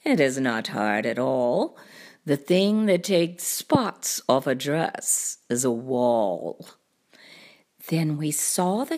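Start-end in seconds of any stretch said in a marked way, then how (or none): 0.0-6.7 low cartoon cat voice; deep show-off storybook cat; boastful cat. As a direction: explain it deep and unbothered.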